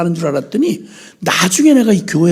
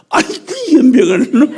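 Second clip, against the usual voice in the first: imitating fond mother; 8 semitones higher, 5 dB louder than usual. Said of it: "이래?".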